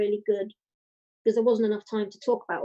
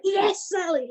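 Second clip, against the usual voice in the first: excited cheer; 10 semitones higher, slower than usual. Retold or imitated. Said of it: "he jumped up from his table and went".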